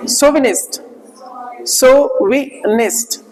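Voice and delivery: सोविनिस्ट with an s sound, not the ch of चौविनिस्ट; सोविनिस्ट spelled out slowly, so-vi-nist; slow correct pronunciation. Shown as a demonstration. Teacher सही उच्चारण सिखाते हुए: सोविनिस्ट. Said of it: "असल में क्या होता है".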